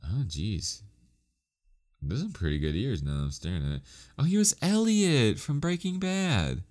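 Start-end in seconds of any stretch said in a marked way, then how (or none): 4.2-6.6 surprised realization. Real, not acted. Sudden recognition while googling actor.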